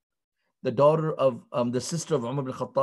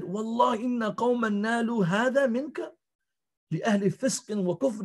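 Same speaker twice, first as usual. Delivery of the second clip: a touch of outraged crying out, raised high; indignant outcry; 7 semitones higher, faster than usual. Retold or imitated. Umm Jamil cries out over the beaten Abu Bakr, mildly outraged. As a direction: cry out in shock at who did this. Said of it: "she screamed because she couldn't".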